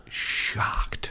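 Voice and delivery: shocked, near-whispered word; imitating a crowd's hushed shock; stunned hush. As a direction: whisper it as if scandalized. Describing crowds' stunned reaction.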